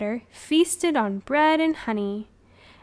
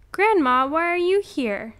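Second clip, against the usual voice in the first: ordinary delivery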